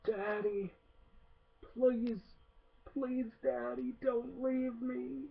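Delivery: high hushed whimpering plea, imitated; dying toy pleading. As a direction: beg like a broken doll dying. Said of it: "he's like, with his last words".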